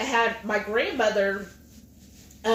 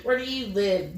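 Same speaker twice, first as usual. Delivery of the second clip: ordinary talk, nothing marked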